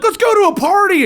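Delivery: high, excited invitation; mock party call. Voices Avengers keen to party.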